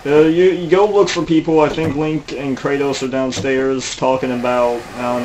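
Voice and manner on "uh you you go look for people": ordinary delivery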